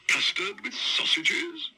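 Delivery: high, slow amazed exclaim. Acted. Paddington exclaims at custard offered with sausages. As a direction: exclaim it in disbelief. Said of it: "he exclaimed".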